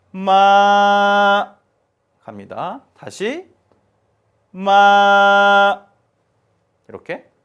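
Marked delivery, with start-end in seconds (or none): 0.1-1.6 마 held level and high; 마 sustained flat on sol, no rise or fall; first tone. Shown as a demonstration. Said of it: "높낮이가 없이 그냥 일정하게". 4.5-5.9 마 held level and high; 마 sustained flat on sol, no rise or fall; first tone. Shown as a demonstration. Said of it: "높낮이가 없이 그냥 일정하게".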